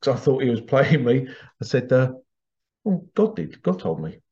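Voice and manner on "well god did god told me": mock-innocent joke answer, jumping high then dropping; joking. Host jokingly answering an online critic, joking. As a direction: toss it off as a joke. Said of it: "as a joke".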